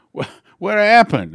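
stammered, high, loud disbelief; healed man baffled at his own legs; astonished. Retold or imitated. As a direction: sputter in amazement at being healed.

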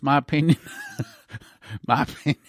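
laughing through the words my opinion. Real, not acted. Host laughing at his own opinion.

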